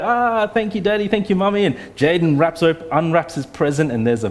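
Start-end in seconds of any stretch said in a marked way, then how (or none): 0.0-1.6 high, mock-delighted child voices; lightly voiced children gushing over presents; excited kids. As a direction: squeal thanks like a thrilled kid.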